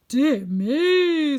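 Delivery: loud, high, booming exclamation. A narrator's reading. Unseen wizard reacts to news, loud and grand. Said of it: "said the voice".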